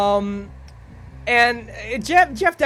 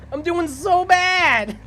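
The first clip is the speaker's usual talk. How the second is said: mock whine; 11 semitones higher, 7 dB louder, much slower than usual. Real, not acted. Streamer whining over losing survivors.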